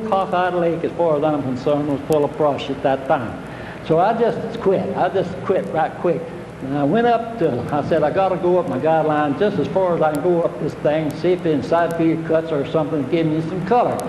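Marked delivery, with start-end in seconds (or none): none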